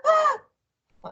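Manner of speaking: loud, very high shriek; scream. A narrator's reading. Wife feeling something slimy in bed, screaming. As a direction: shriek in sudden horror. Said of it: "screamed Missus Twit".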